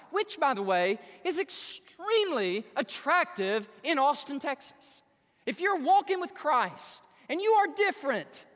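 high, loud pulpit exhortation; impassioned preaching. Real, not acted. Preaches with full force.

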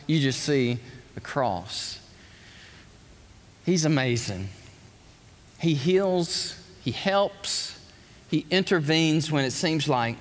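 ordinary talk, nothing marked

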